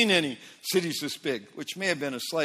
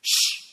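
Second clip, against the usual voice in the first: sharp, high hushing shh to silence a crowd; loud shush; 4 dB louder than usual. Retold or imitated. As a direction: shush the whole crowd at once. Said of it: "he silences the people".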